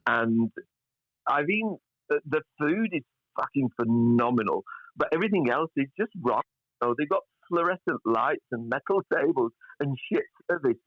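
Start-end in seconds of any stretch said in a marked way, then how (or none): none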